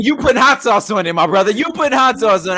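loud, high-pitched protest; mock outrage. Real, not acted. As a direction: shout it high over the other talker. Mock outrage in heated argument.

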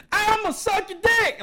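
very high, loud mock shriek; imitating a drunk man screaming on a train. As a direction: shriek it at the top of your voice. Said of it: "just screaming".

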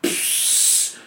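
unvoiced psst; stage whisper. Drunk Nemeth signalling during points, whispered psst. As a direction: hiss for attention. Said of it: "Nemeth is literally like".

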